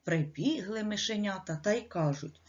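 ordinary delivery, nothing marked

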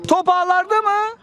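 rising excited goal call; excited commentator. Real, not acted. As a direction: call it high and eager.

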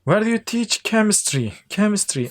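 "chemistry" with a hard K, never Ç; K sound in chemistry. Shown as a demonstration. Teacher models "chemistry" with a K sound for students. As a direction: say "Chemistry" starting with K not Ç. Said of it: "K sesiyle telaffuz ediyorduk".

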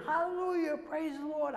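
whooping it up in retelling; mock scream. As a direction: imitate a friend's jubilant praise.

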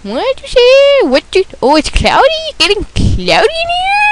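rising to a high squeaky shout; confused squeal climbing to a shout; mock confused. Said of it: "a doubt".

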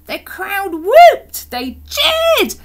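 high, loud, whooping storytelling; excited narration. A narrator's reading. Reader telling of the crowd's cheers, excited narration.

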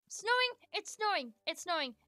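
gleeful announcement of snow, winding down; excited cry. Real, not acted. Sees snow start in game.

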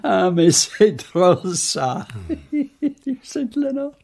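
laughing through the words, high and bursting; amused laughter. Acted. Poirot laughing at the Saint Leonard's joke, amused. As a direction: chuckle delightedly at the silly joke. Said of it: "Poirot seemed inordinately amused".

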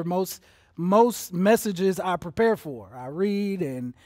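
ordinary delivery

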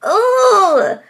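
high, loud strangled groan of frustration; a near-crying ugh that breaks out loud; frustrated wail. Retold or imitated. Fed up with the bugs, a loud frustrated near-cry groan. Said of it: "so frustrated I couldn't even cry".

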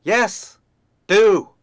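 loud, high dismissive snap; curt hen. Acted. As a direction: send them off sharply. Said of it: "said the hen".

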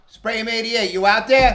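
high, loud shout-out; calling out. Real, not acted. Calling out to Spray 'em eighty-eight, high and loud. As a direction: call out to him across the crowd.

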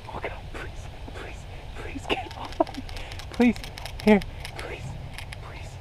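frantic whispered pleading; whisper, begging it to get off; terrified whispering. Real, not acted. Monkey on her, pleading.

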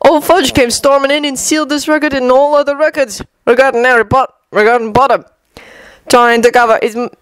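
high, scornful barman; mocking the minister; barman Tom voice.

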